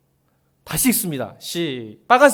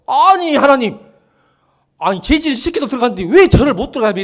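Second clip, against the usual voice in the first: higher, loud indignant protest; Moses protesting; 7 semitones higher, 6 dB louder than usual. Acted. Moses protesting to God for being barred, loud and high. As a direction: argue back at God, raising the voice.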